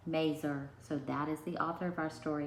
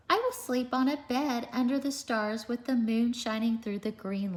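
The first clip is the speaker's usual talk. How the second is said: higher, lively storybook reading; animated picture-book read-aloud; reading to children; 5 semitones higher, 6 dB louder than usual. A narrator's reading. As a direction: read it out to a class of little kids.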